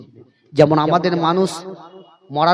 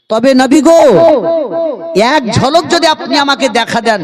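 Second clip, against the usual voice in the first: pleading call; 10 semitones higher, 3 dB louder than usual. Acted. Devotee begging the Prophet for a glimpse, high pleading call. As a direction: call out imploringly to the beloved Prophet.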